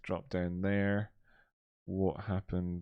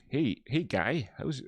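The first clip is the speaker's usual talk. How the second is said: South Park voice; 6 semitones higher, slower than usual. Retold or imitated. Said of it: "That, that clip in, uh, in South Park".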